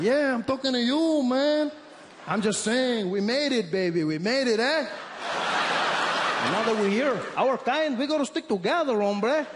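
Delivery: high loud Mexican English, buddy-buddy; Mexican accent. Retold or imitated. Mexican stranger telling Trevor our kind stick together, loud Mexican accent. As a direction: claim him as one of your own. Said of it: "He said".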